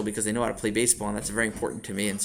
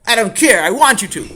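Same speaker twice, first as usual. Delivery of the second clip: put-on high, loud child's tantrum; defiant yell; 10 semitones higher, 13 dB louder than usual. Sammy dares father to take privileges away, defiant yelling. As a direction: throw it back at full volume. Boy defies threatened punishment.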